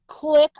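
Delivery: clicking like a gun hammer; sharp mouth click; gun click sound. Retold or imitated. Imitating a gun cocking.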